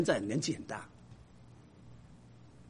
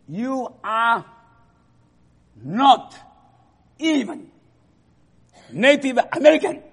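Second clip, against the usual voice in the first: angry student; 8 semitones higher, 10 dB louder, slower than usual. Retold or imitated. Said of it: "这个人很不高兴".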